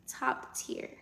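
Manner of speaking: whispered top tier; whispering. Real, not acted. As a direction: breathe it out without voice. Repeats the phrase hushed, then loud.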